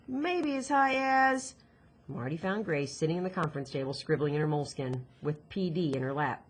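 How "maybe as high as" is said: musing. Acted. Diary list read aloud, mused.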